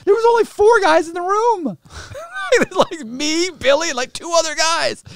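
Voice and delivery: very high, loud disbelieving outburst; loud incredulity. Real, not acted. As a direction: shout it in real amused outrage. Incredulous at number of snitches.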